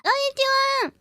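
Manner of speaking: high, loud sing-song hello; high greeting. Real, not acted. Streamer greeting chat in Japanese, high and loud.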